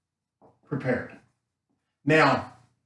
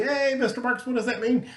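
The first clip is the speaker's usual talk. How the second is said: much higher-pitched mock student question; interrupting student; 9 semitones higher than usual. Imagined student interrupts Mister Marks to ask, piping up. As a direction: pipe up like a student calling out to the teacher. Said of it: "You can't say".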